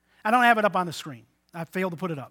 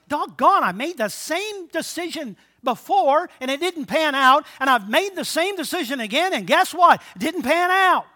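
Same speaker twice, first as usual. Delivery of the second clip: exasperated; 7 semitones higher, 7 dB louder than usual. Retold or imitated. Exasperated self-talk quote.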